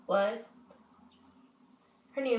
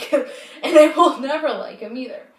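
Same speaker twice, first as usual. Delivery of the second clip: laughing loudly; 3 semitones higher, 11 dB louder than usual. Real, not acted. Venting about her ex.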